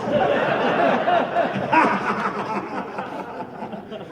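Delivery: loud laughing; hearty belly laugh; big laugh. Real, not acted. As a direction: laugh hard at your own joke. Laughing at own joke.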